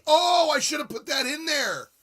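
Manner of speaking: sudden thrilled shout; excited outburst. Real, not acted. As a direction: blurt the idea out.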